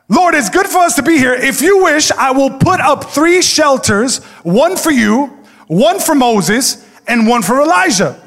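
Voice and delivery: high, loud, excited babbling Peter; eager Peter. Acted. Pastor playing excitable Peter offering to build shelters, high and loud. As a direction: gush like an overeager disciple.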